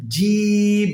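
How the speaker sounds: "জিম" held long on one level note, tongue middle to palate; drawn-out jim. Shown as a demonstration. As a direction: stretch the vowel of "জিম", then close on the m. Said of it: "উপরের তালুর সঙ্গে লাগবে". ("জিম" জিহ্বার মাঝখান তালুতে লাগিয়ে লম্বা টেনে)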